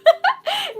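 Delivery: a sudden very high laugh; loud high laugh. Real, not acted. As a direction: crack up. Laughing about yelling drivers.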